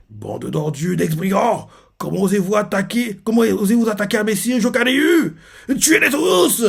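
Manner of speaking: monte de plus en plus haut jusqu'au cri final; un lieutenant furieux qui ordonne le massacre; cri de rage. Retold or imitated. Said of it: "qui crie ça". (cri de colère imité, de plus en plus aigu)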